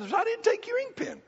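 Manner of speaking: high mock-innocent denial; indignant denial. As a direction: squeak it out as the accused Ed. Ed denies taking the pen.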